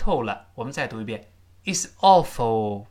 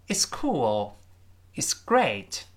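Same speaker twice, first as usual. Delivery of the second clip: whisper; much slower than usual. Acted. Answer line whispered.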